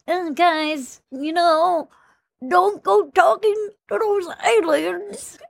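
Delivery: high, slow alien-ish Elon voice; Elon Musk impression. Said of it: "your impressions are spot on".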